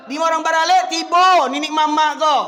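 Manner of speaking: loud, high preaching shout; shouted rebuke. Real, not acted. Preacher berates the clan elders before congregation, shouting. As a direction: thunder it out at full force.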